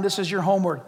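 ordinary talk, nothing marked

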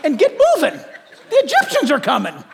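a hint of a laughing, impatient God; amused God. God tells Moses to stop preaching, lightly laughing. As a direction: tell him to hush with a laugh. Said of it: "but God says".

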